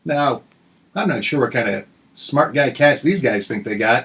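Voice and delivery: ordinary talk, nothing marked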